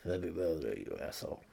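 very soft, low, barely voiced; muttered birthday greeting. Guest insulting birthday man, muttered. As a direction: mutter it under your breath.

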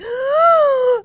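sharp high gasp of a shocked class; loud shocked gasp. Retold or imitated. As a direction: gasp as if something scandalous was said. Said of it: "they gasp audibly".

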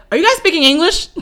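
eager burst at hearing English; delighted, hopeful ask; excited. Retold or imitated. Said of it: "'Cause I was super excited".

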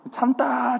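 참다 high, its end stretched; strained enduring. Pretend. Preacher acting out patience running out, 참다 stretched high. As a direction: hold out 참다 on a high pitch.

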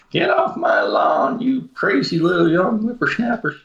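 put-on cranky old man shouting at kids; old prospector. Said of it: "back in my day".

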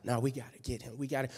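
low, hushed urging; conspiratorial undertone. Retold or imitated. Pharisees working the crowd, conspiratorial undertone. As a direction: drop it low, as if passing word along. Crowd whisperers inciting in retold scene.

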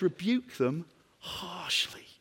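preacher whispering the apostle's stern command to the congregation; whispered quote. Acted. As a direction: whisper Rebuke them harshly, drawn out slowly. Quoting Paul's command.

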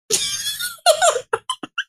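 shrill squeal of laughter; high squealing laugh. Real, not acted.

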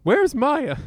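loud, high, hammed-up demand from the villain; angry hero. Hero demands his daughter from the villain, loud hammy shout. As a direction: bark it out like a cheesy game hero. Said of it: "you will be playing James Heller".

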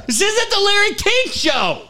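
high, laughing reproach; mock scolding. Screener telling Ted off, high laughing reproach. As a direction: tease the host sharply. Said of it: "That's what I said to him".

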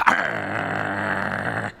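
a short, loud bulldog snarl; dog growl. Acted. The bulldog Spike growls at the old man with the mangy dog. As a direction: growl like a dog warning a stranger. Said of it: "Spike gives this old man with his mangy dog a little".

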